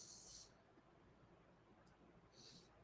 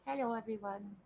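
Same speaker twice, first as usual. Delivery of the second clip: voicing the pet; 4 semitones higher than usual. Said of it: "He's like".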